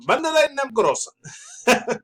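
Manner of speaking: high, slow, hammed-up mock Japanese accent with a laugh. Vlogger mocks a Japanese company name in a jokey Japanese accent. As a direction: stretch out the Japanese-accent "Bandai Namco-san". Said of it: "when I do their little accent".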